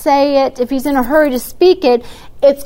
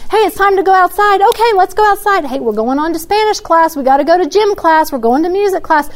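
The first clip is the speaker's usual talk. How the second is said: lightly voiced teacher calling kids, higher; a hint of a cheery classroom announcer; sing-song teacher; 6 semitones higher than usual. Parent imitating a teacher moving kids between classes, mildly sing-song.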